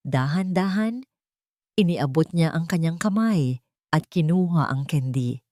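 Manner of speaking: ordinary delivery, nothing marked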